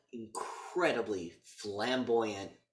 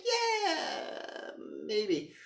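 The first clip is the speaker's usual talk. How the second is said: high mock hesitant woman. Voicing a hesitant bride.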